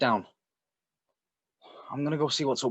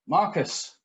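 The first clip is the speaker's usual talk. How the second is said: raised, uneasy call into the dark; 6 semitones higher, 4 dB louder than usual. Acted. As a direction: call out to an unseen neighbour. Calling into the dark house.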